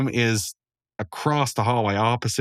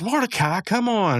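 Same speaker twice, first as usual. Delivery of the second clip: high, exasperated mock plea; pleading call; 11 semitones higher than usual. Rigby urging Mordecai at the computer room, high pleading. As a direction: whine it up high to a friend.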